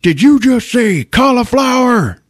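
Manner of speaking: very high, loud, drawn-out shout; retold yell. Bartender yelling at the camera, loud drawn-out mock shout. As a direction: bellow it high, stretching the words. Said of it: "just yells at the camera".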